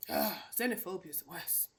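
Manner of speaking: ordinary delivery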